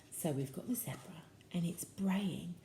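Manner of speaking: soft whispered aside; whispering. Real, not acted. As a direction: hush it to a whisper. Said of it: "whispered aside".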